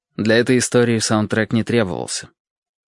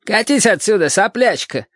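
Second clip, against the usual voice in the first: Marguerite shouting; 10 semitones higher than usual. Acted. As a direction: bark off the little girl. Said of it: "услышал я".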